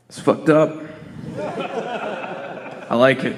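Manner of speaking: put-on lightly done thick New York accent, laughing through it; imitating a New York producer's thick accent. Producer giving his verdict, lightly imitated New York accent. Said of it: "he's a real thick New York accent".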